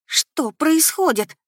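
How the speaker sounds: high, drawn-out alarmed question; as if holding back a scream of fear; frightened. A narrator's reading. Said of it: "едва не закричала от страха". (испуганно, почти срываясь на крик)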